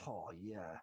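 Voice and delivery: low, quiet lecherous oh yeah; a hushed put-on leer; very low mock leer. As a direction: breathe it out low like a creep. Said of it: "bunch of people that go".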